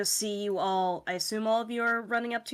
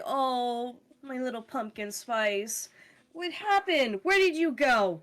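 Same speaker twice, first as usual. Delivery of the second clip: high, sing-song cooing questions; doting pet talk; 4 semitones higher than usual. Acted. Owner holding the cat that escaped, high doting fuss. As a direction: coo and fuss over your pet.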